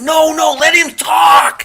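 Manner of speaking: high, drawn-out shout over the crowd; Master Chief hollering. Retold or imitated. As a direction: imitate a chief calling over hecklers. Master Chief calling over the yelling.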